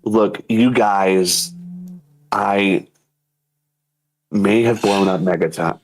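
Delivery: low, very slow, halting mock contrition; YouTuber apology. YouTuber confessing about Megaton, low, halting mock apology. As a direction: drag it out with heavy pauses. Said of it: "a YouTuber apology video".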